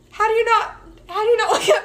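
half-yell at a sleeping partner; exasperated yell. Retold or imitated. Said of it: "I'm like".

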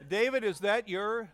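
laughing through the question; amused, words broken by laughter. Real, not acted. Preacher laughing at audience joke.

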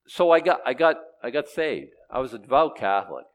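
normal talking voice

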